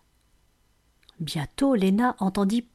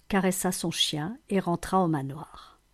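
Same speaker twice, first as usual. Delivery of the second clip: ordinary delivery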